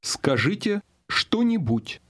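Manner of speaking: normal talking voice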